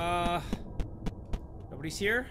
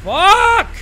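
high, loud swearing yell; alarmed outburst; panicked shout; 14 semitones higher, 11 dB louder than usual. Real, not acted. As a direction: yell it as things go badly wrong. Caught out in game.

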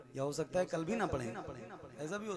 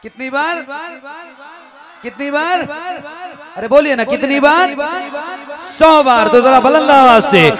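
raised, higher call to the crowd; rousing the audience; 9 semitones higher, 25 dB louder than usual. Real, not acted. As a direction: call out for a response, pressing a little harder each time. Rousing audience to answer louder.

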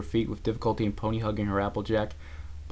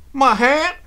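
high, loud, short question; startled question; 12 dB louder than usual. A narrator's reading. Applejack hearing her hat is gone, startled and loud. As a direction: blurt it out in alarmed disbelief.

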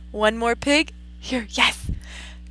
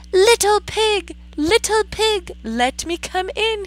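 a hint of wolf, higher and louder; big bad wolf; 9 semitones higher, 5 dB louder than usual. Wolf at the pig's door, lightly voiced wolf. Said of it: "he said".

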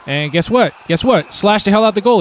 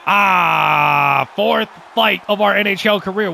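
excited battle cry; slower than usual. Real, not acted. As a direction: roar it out like a brawler. Hyped by starting another fight.

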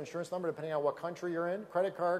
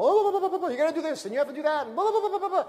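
high, loud gushing blah-blah babble; mock pushy recruiter; 9 semitones higher, 5 dB louder, faster than usual. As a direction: parody an overeager recruiter dumping a pitch on someone. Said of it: "they're throwing up all over people".

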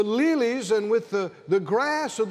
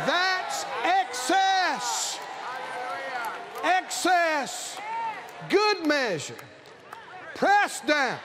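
slow, drawn-out preacher's proclamation; full-force preaching; 5 semitones higher, slower than usual. Acted. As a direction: stretch the key words as a rousing shout to the congregation.

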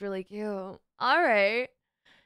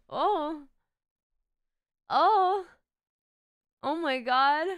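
shocked gasp; 6 semitones higher, 5 dB louder, much slower than usual. Real, not acted. Reacting to shocking model.

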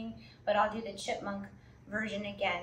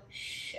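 soft hushing shh breath; ocean breath shh; 8 dB quieter than usual. Shown as a demonstration. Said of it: "let the wave just keep going".

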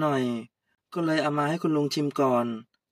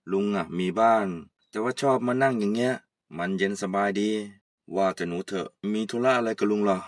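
put-on much lower, easygoing old man greeting; old uncle; 7 semitones lower than usual. Old uncle greeting Kaew, much lower. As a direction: drop low like a relaxed old uncle. Uncle explains he has home.

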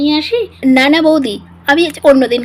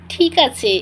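ordinary talk, nothing marked

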